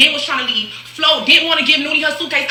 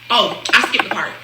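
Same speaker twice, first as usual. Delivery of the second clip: ordinary delivery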